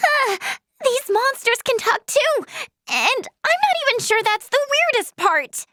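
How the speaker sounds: high, alarmed astonishment; startled disbelief. Acted. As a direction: blurt it out, freaked by the discovery. Startled by talking monsters.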